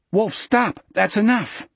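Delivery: high, alarmed cry to stop; urgent plea. A narrator's reading. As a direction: call out sharply with the pitch jumping up. Jack cries out to stop Wolf.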